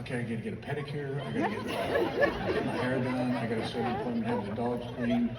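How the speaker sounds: quiet, quick muttered to-do list under her breath; wife listing errands half to herself in the car; Gail muttering to herself. Retold or imitated. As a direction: mumble the list to yourself, not to anyone. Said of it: "this is what I'll hear from Gail".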